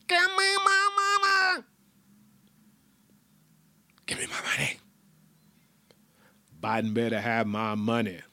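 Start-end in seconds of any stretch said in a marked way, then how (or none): 0.1-1.6 squeaky high demand, a bit slow. Acted. 4.1-4.8 hushed whisper of the demand. Acted. Repeated as whisper.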